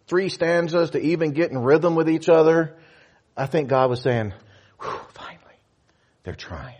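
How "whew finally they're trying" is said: breathy whispered sigh of relief; relieved whisper. Pretend. God quietly relieved that the church is trying, whispered.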